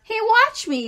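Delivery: put-on very high, loud boyish yell; show-off shout for attention. A narrator's reading. Boy showing off in the crate, yelling. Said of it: "yelled Avery".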